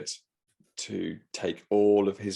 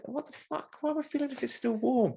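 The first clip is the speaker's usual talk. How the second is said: much higher, shocked disbelief; baffled at being asked to feel it; incredulous squeak. Retold or imitated. Retelling his shock at the seller to a friend, high squeaky disbelief. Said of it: "I'm like".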